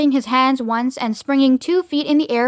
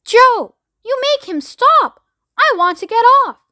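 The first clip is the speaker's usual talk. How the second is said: high, loud frantic demand; panicked yell; 11 semitones higher, 5 dB louder than usual. A narrator's reading. Grandma Josephine begging Joe to get her off, yelling. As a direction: yell it high over the noise. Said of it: "yelled Grandma Josephine".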